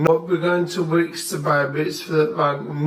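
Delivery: slow, drawn-out broad Yorkshire; thick Yorkshire accent. Shown as a demonstration. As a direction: stretch every word in thick Yorkshire. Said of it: "a right thick Yorkshire accent".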